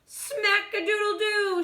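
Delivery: high exclaiming shout. Acted. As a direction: crow it loudly. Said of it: "shouted the kitty boy".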